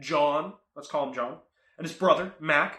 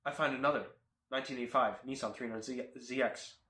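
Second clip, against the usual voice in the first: ordinary talk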